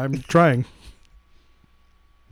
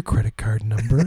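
sexy phone voice; 7 semitones lower than usual. Pretend. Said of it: "do your sexy phone voice".

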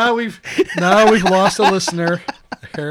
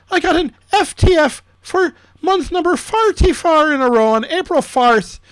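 hammed-up fake Irish brogue, higher and louder; bad Irish accent; 6 semitones higher, 4 dB louder than usual. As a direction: play it as a jokey, overdone Irish accent. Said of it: "it's this bad Irish accent that I'm doing".

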